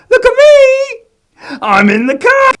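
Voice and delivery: very high loud squeal; gleeful squeal. Acted. Man boasting to kids, high squeal. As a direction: crow with delight.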